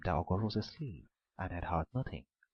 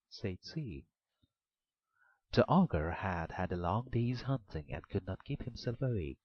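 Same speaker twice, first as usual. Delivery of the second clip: usual speaking voice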